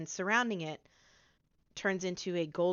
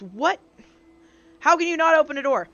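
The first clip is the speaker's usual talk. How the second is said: loud, much higher, fast disbelief; exasperated disbelief; 9 semitones higher, 10 dB louder, faster than usual. Acted. Tabby watches the hero fail at the door, loud high disbelief. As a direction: throw up your hands, can't believe it. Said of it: "she goes".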